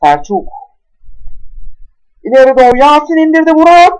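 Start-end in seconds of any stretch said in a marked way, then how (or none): 2.2-3.9 excited goal call. Acted. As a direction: call the goal with a rising thrill. Goal call in football game.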